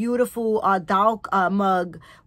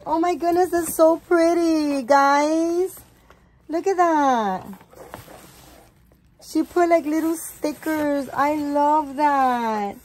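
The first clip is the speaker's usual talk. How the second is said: gushing delight; 6 semitones higher, 5 dB louder than usual. Real, not acted. Admiring the gift wrapping.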